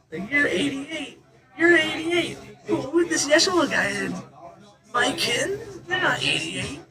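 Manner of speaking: put-on very high, baffled child voice; little kid. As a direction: squeal it like a puzzled kid. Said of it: "giggling about it like a kid".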